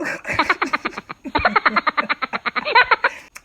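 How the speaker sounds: loud, long laughing fit; éclat de rire prolongé; long loud laughter. Real, not acted. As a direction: crack up hard. Laughing about taking a plane.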